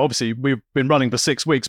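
usual speaking voice

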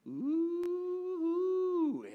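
high swooning coo; swooning ooh. Acted. Pastor recalling falling in love, a lovestruck coo.

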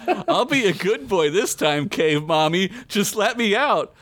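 high, whiny begging voice, climbing; pleading boy. Acted. Trapped boy begging cave mommy to let him out, pleading.

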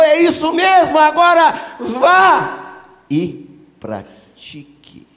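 high, full-force shouted praise; thundering acted command, slowing at the end; Jesus. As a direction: shout the compliments like sword strikes. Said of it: "Aí Jesus tira a espada".

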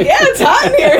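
high, loud quote through laughter; laughing imitation. Eddie covers for Jane's fanning, laughing and high. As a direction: squeal the excuse while cracking up.

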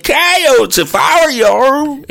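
put-on high, loud old prospector warning. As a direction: warn him like a crazed old miner. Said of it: "that's old Prospector".

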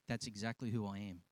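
low, soft understated answer; quiet assurance. Acted. Jesus answering the outraged Pharisees, quiet and low. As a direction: drop right down, calmly certain.

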